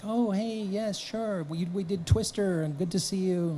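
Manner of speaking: high, soft impression of a film director; Steven Spielberg. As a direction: imitate a director's polite greeting, pitched well up. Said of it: "and he's like".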